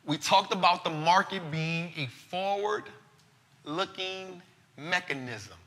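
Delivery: slow, soft, measured advice; solemn wise words. Acted. Host closing his show with wise words for listeners, slow and soft. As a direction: lay it down slow, like an elder's counsel.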